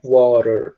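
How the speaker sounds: "water" with an R-like flap for the T; American "water", tapped T, R sounded at the end. Shown as a demonstration. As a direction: say "water" with the T melted into an R. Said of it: "como se fosse um R".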